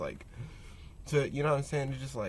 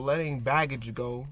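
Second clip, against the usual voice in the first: ordinary delivery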